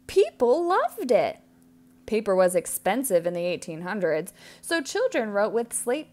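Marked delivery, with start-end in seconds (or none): 0.1-1.4 higher and louder, bright payoff; storybook delight; cheery. A narrator's reading. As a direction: lift it up happily.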